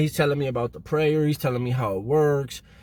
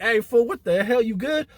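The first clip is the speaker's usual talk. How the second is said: higher-pitched, alarmed check-in; acted startled concern, pitched up; startled cellmate; 9 semitones higher than usual. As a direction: imitate a cellmate woken by yelling.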